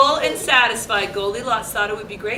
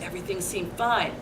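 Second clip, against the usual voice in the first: plain reading voice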